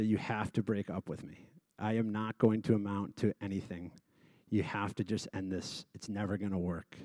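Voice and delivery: quiet, low retelling of giving up; dejected plea. Retold or imitated. Retelling despairing breakup phone call.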